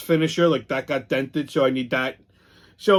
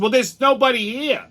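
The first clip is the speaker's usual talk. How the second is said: a little nasty; 9 semitones higher, 3 dB louder than usual. Retold or imitated. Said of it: "a little fucking nasty".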